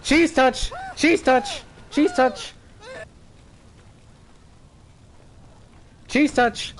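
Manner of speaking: high chanting taunt; teasing shout. Real, not acted. Chanting while tagging players.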